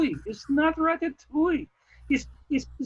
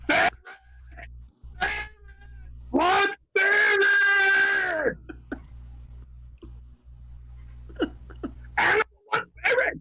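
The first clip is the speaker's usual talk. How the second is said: Animal shout; 6 semitones higher, slower than usual. Acted. Animal yelling at the others about the ferret, shouting. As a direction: roar it like a wild Muppet drummer. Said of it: "give me characters from The Muppet Show".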